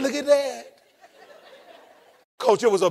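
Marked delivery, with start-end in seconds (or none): 0.0-0.8 softer, laughing in wonder; soft amazed chuckle. Retold or imitated. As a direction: marvel at it with a laugh. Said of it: "I said".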